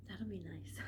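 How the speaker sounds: ordinary talk, nothing marked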